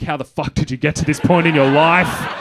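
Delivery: loud, laughing self-reproach; disgusted with himself, half laughing; inner exasperated yell. Retold or imitated. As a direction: shout at your own reflection in disbelief. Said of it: "I think".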